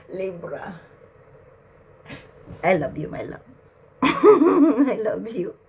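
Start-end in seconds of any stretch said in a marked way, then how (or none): none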